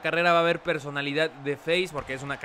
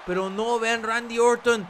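wrestling commentator; 5 semitones higher than usual. Acted. Live play-by-play.

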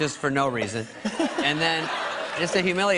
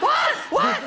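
much higher, loud squeaked what; shocked disbelief; 5 dB louder than usual. Retold or imitated. Pledge shrieks at his brother's betrayal. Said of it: "And I'm like".

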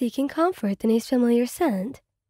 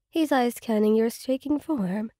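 plain reading voice

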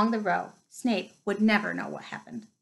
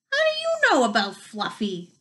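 Hagrid voice; 2 semitones higher than usual. Acted.

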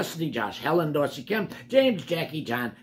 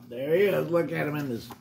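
ordinary delivery, nothing marked

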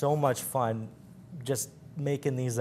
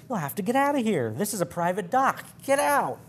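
much higher, frantic yelling; bellowed; 8 semitones higher than usual. A narrator's reading. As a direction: chase the ship off, rising to a shriek. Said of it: "he bellowed to the skipper".

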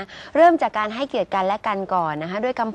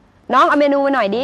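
louder, higher, demanding call; imitated rude diner; 4 semitones higher, 7 dB louder than usual. Retelling a diner calling a young waiter, brusquely demanding. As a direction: mimic someone ordering the waiter about. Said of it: "[name]เคยเจอเลยนะ กับตัวเลยนะ".

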